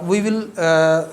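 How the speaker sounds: ordinary talk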